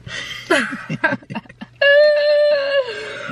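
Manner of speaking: high burst of laughter; laughing. Real, not acted. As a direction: break into a loud laugh. Laughing at the child's answer.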